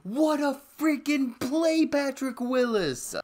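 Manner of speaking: high, loud excited cheering; hyped yelling. Real, not acted. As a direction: cheer the play at full volume. Hyped over big defensive play.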